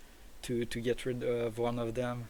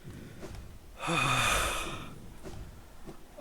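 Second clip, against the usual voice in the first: long relieved sigh; 3 semitones higher, 3 dB quieter than usual. Real, not acted. Relief after victory.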